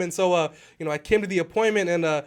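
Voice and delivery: ordinary talk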